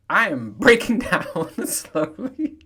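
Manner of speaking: slow, words broken by real laughter; laughing confession. Real, not acted. Telling listeners his body is failing, laughing slowly. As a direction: laugh through the admission.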